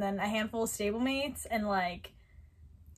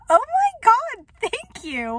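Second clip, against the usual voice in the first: much higher and louder delighted gasp; thrilled gasp of thanks; 11 semitones higher, 8 dB louder, slower than usual. Retold or imitated. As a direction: burst out in grateful joy. Retelling her thrilled reply.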